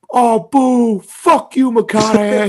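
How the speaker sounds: high, loud heckling shout; retold booing. Booing McConaughey at the festival, high loud jeer. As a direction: boo him off stage. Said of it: "I immediately went".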